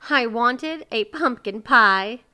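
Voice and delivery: slow, loud, tearful complaint; stuffy with tears. A narrator's reading. Miser confesses his wish to neighbors, tearful. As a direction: wail it slowly through tears. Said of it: "his voice was stuffy with tears".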